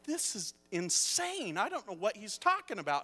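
high-pitched exasperated disbelief; retold fury. Son retelling his baffled reaction to his dad, furious. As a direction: stretch it out in indignant disbelief. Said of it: "I was getting furious".

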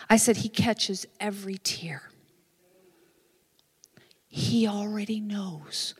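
soft retold pastoral consolation; tender reassurance. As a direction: let each phrase land gently with long pauses. Retold pastor comforting crying woman.

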